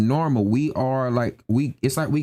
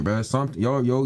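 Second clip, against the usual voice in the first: normal talking voice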